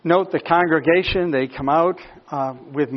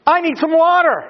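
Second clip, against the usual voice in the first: thirsty complaint; 11 semitones higher, 6 dB louder than usual. Retold or imitated. Voicing thirsty complaining Israelite.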